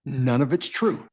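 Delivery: usual speaking voice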